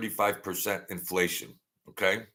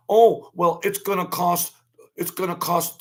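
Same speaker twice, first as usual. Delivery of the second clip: mock whiny Democrats, pitched up; mocking whine; 7 semitones higher, 3 dB louder than usual. Mocking the Democrats' crying, whiny. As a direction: parody their complaint, high and whiny. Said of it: "this is their logic".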